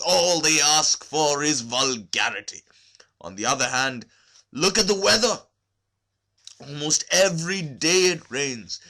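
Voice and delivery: loud, high, self-pitying rant; despairing complaint. A narrator's reading. Kukin pouring out his troubles to Olga, loud self-pitying rant. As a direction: pour out every grievance to her, wailing. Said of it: "He threw up his hands in despair".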